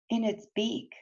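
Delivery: ordinary delivery, nothing marked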